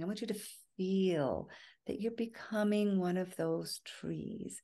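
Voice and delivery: soft, unhurried guided-imagery voice with long pauses; guided meditation. Real, not acted. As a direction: ease listeners into relaxation, phrase by phrase. Continues imagery after long pause.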